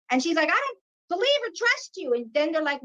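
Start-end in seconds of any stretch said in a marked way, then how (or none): 0.5-2.1 retold distrust. Voicing daughter's refusal to the beings.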